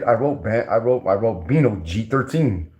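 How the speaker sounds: ordinary talk, nothing marked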